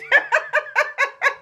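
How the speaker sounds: can't hold the laugh in, high and free; high burst of laughter. Real, not acted. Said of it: "Let me laugh".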